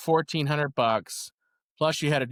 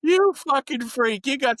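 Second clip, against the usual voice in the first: excited teasing; 9 semitones higher, 4 dB louder than usual. Real, not acted. Teasing friend, laughing.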